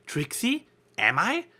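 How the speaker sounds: high puzzled question; a lightly voiced baffled flier, pitch jumping up; confused. A narrator's reading. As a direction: caught off guard, unsure. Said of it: "Rainbow said in a confused voice".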